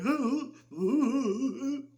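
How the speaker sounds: hammed-up monster excitement; excited monster grunts. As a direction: hoot like a thrilled, simple creature. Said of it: "And he's like".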